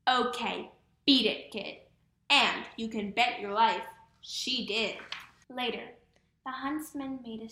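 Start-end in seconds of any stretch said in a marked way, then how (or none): none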